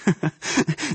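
soft, short chuckle; a light amused laugh. A narrator's reading. Mother laughs at her daughter's question, amused chuckle. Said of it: "Die Mutter lachte".

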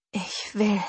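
breathless, unvoiced whisper. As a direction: breathe it out, barely there. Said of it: "flüsterte ich". (gehauchtes Flüstern)

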